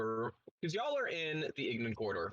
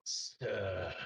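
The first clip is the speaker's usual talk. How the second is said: poison in his voice. Acted. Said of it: "he has poison in his voice".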